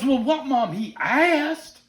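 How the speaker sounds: high, loud mock child's protest; protesting little boy. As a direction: imitate a kid defending himself to mom. Retold child defends himself to mother.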